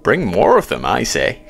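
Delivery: loud, animated American joker; chummy American, ending in a giggle; jovial American. A narrator's reading. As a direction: joke heartily in an American accent. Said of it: "A jovial American voice".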